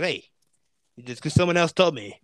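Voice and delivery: lightly voiced traffic cop; a hint of an imitated cop; cop impression. Cop explains stop to driver who just left work, lightly voiced impression. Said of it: "doing this impression for this guy's voice".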